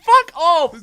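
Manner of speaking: loud, high burst of disbelief; amused, disbelieving yell; incredulous shout. Real, not acted. Disbelief at spaceship claim.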